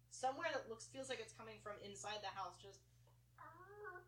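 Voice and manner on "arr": high cracking howl that breaks; failed wolf howl. Acted. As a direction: try a wolf howl, voice gives out. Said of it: "can't even do a goddamn wolf howl 'cause my voice".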